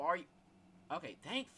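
ordinary delivery

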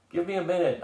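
high-pitched, harried plea; pleading. A narrator's reading. Leader put on the spot for a plan, pleading. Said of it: "I pleaded".

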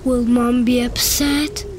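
ordinary delivery

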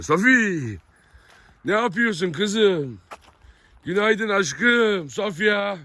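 slow, high, drawn-out cooing; doting pet talk. Real, not acted. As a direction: stretch each word lovingly to the dog. Doting baby talk to Sofi.

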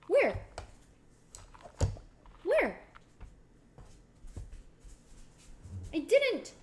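high, loud startled where; jumping at the news something fell. Real, not acted. Told she dropped one, startled and loud.

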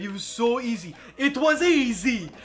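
high, loud, slowing smug teacher voice; the famous quote. Retold or imitated. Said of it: "and then the famous quote".

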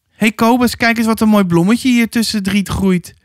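high-pitched holler to a mate; shouted call. A narrator's reading. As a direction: call out your find to your companion. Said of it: "schreeuwde hij". (hoog geroepen naar een kameraad)

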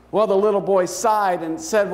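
ordinary talk

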